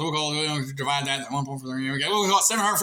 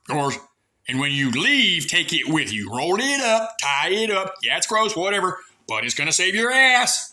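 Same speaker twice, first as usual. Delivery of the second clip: ordinary delivery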